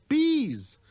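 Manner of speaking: put-on a curious boy calling out; little prince. Prince voiced.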